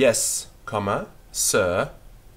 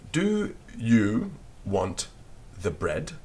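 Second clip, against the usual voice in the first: very slow dictation; slower than usual. Shown as a demonstration. Teacher repeating the sentence for pupils to copy, very slowly. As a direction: Do you want the bread drawn out very slowly. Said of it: "we wouldn't actually say it quite like that".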